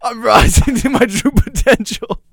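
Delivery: high, loud, drawn-out proclamation; mock-heroic outburst. Host told not to be great, mock-heroic outburst. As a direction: belt it out slowly like a rising hero.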